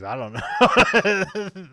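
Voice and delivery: drawn out through real laughter; laughing. Real, not acted. Laughing at a joke about seventies movies, drawn out through laughter. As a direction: say it slowly while cracking up.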